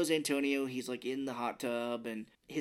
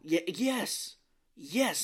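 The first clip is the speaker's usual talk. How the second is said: excited agreement; 6 semitones higher, 4 dB louder, much slower than usual. Real, not acted. Delighted at co-host's correct guess.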